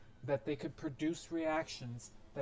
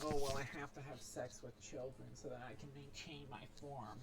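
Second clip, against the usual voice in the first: softer, mocking imitation of an excuse; mocking whine; 7 dB quieter than usual. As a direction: sneer it out as their lame excuse. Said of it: "don't give me that".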